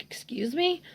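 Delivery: high, soft incredulous question; mock disbelief. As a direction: retell a son's taken-aback reply. Son's indignant reaction retold.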